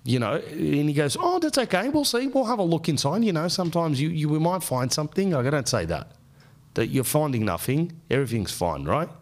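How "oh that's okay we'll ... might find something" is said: much higher, breezy mock doctor. Doctor reassures nervous patient about the scans, much higher. Comedian imitates his ENT doctor.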